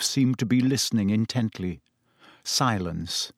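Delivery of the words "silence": ordinary delivery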